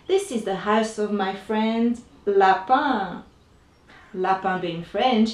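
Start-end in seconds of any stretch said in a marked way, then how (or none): none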